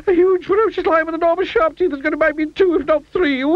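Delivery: very high, babbling terror rising to a wail; hysterical panic. A narrator's reading. Mister Jelly fears a huge lion, hysterical panic. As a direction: work yourself into a squealing frenzy. Terrified character raves about a lion.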